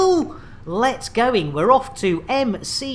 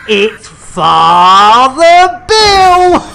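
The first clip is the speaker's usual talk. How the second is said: triumphant shout; 3 semitones higher, 12 dB louder, much slower than usual. Real, not acted. Streamer revealing to chat who goes out the airlock: slow triumphant shout. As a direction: stretch it out like a ringmaster.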